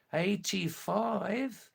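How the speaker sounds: slow, high, disbelieving eighty-five; surprised. Real, not acted. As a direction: stretch the number out in real astonishment. Disbelief at a viewer's message.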